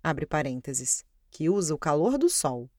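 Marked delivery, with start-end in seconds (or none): 0.0-1.0 low, slow aside voice; dictating. Acted. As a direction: drop the voice to read out the brackets. Reads the brackets aloud.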